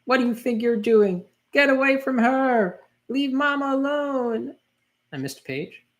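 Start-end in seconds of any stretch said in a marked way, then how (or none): 0.0-4.5 hammed-up brave boy yelling; boy's shout. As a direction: yell at the villain to protect Mama. Boy defends his mother.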